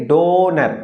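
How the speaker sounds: Donor said slowly, the O pushed; stress on the O. Shown as a demonstration. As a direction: say donor so the O stands out. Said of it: "तो donor बोल के देखिए".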